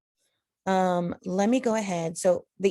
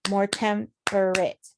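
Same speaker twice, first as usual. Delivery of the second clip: slow "more temperate", each syllable separate; broken into syllables; slower than usual. Shown as a demonstration. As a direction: split "temperate" into three measured syllables. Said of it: "temperate is actually three syllables".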